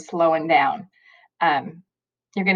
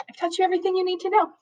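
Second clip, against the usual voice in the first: much higher, quick, sing-song emphasis on know; emphatic high repeat; 13 semitones higher, 3 dB quieter, much faster than usual. Real, not acted. Teacher assuring her students they know everything, high and fast. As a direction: lift it way up and rush it as the clincher.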